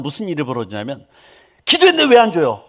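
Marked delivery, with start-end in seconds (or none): 1.7-2.6 loud, higher whiny protest; imitating a believer arguing with God; mock demanding complaint. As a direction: argue with God like a sulky child. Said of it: "막 이렇게 따지는 거".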